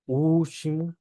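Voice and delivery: último as "UU-chi-mu" with a long u; Portuguese pronunciation demo. Shown as a demonstration. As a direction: drop the l of último, lengthen the u. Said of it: "como una U larga".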